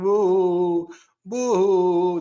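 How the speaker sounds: short loud imitated wails, woo then boo; mock howl. Teacher imitates mourners howling in sorrow, loud mock wail.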